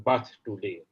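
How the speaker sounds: declaimed openings, settling into measured verse; poetry recitation. Acted. Poet reading the translated version to the audience, poetry recitation. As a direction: recite the verse line by line with deliberate pauses.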